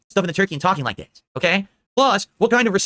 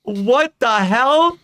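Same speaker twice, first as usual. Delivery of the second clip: higher, louder, slowly stretched what the hell; shocked disbelief; 5 semitones higher, 4 dB louder, much slower than usual. Real, not acted. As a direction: drag it out in stunned disbelief. Shocked at the PETA post.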